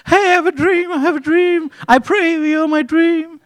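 put-on a giddy kid showing off his dream; naive dreamer Joseph. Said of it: "He's like".

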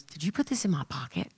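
low, soft retold question; retold puzzled question. Jay asking her about a gift card, quiet retold question. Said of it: "and he goes".

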